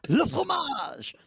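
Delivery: high, slow cartoon Frenchman; mock French accent. Teammate piling onto the French joke, mock French accent. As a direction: draw it out in a hammed-up French accent. Joking along with the French answer.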